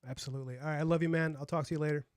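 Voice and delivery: normal talking voice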